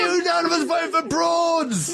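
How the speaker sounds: high, shouted victory cry; triumphant shout. Real, not acted. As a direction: yell the result like a winner. Announcing the vote result.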